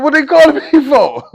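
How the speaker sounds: loud, high-pitched questioning; worked-up shout to everyone around; paranoid demand. Retold or imitated. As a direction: ask it urgently, almost yelling. Retold panicked questioning of inmates.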